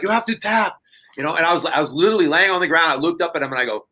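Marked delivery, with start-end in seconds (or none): 0.0-0.8 mild mock yell, pitched up without volume; retold screaming. Coach scolds the choked-out wrestler, mild mock screaming. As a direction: imitate his freak-out at a higher pitch, holding back the volume. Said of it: "he's, like, screaming at me".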